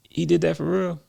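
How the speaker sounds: high incredulous question, retold; amused disbelief. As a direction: act out delighted surprise. Acted amused disbelief at a story.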